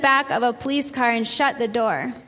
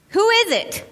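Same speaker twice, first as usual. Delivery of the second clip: sharp, much higher retold angry question; angry demand; 9 semitones higher than usual. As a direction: snap it at the driver like an angry passenger. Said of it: "in a very angry tone".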